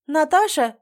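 loud, high call; calling a name. A narrator's reading. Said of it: "позвала она".

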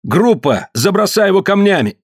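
high rallying cry; shouted incitement. A narrator's reading. Tombol calls the group to throw stones, high cry. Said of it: "закричал Томбол".

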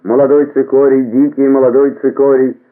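high, loud greengrocer's call; hawking vegetables to the whole street; street vendor's cry. A narrator's reading. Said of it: "А зеленщицы кричали".

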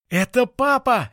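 very high, slow delighted cry; joyful. Acted. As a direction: cheer at seeing dad. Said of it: "ликовал Пых".

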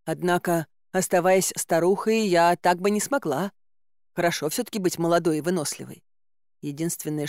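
ordinary delivery

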